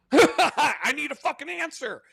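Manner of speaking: mock outrage at an unanswered text; exasperated outburst. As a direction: blurt it out, fed up and baffled. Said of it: "And now you're like".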